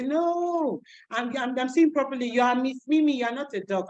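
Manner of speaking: put-on high childish insistence; kids' voice. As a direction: correct the teacher like a kid. Voicing kids.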